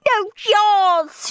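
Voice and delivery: high shouted retort. A narrator's reading. Margaret shouts back at Henry, high and angry. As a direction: fling it back at him. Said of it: "shouted Margaret".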